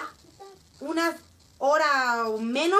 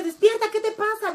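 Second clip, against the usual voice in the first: high, loud urgent call to wake him; alarmed shout; 9 semitones higher, 5 dB louder than usual. Retold or imitated. Father shakes his frozen friend and shouts him awake, high and loud.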